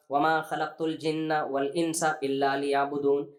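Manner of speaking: slow, drawn-out chanting of the verse; Quranic recitation. Acted. Preacher quoting the Quran to his audience, slow recitation. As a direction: recite it slowly as tilawat.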